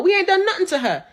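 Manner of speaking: normal talking voice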